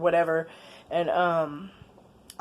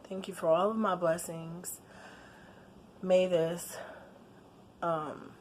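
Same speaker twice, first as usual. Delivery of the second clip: saying grace; 5 dB quieter than usual. Real, not acted. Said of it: "say grace".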